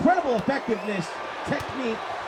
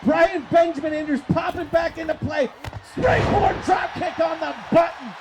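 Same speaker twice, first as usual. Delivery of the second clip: excited play-by-play; 8 semitones higher than usual. Real, not acted. Wrestling commentator calling a sudden reversal, excited. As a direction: call the action at a high excited pitch.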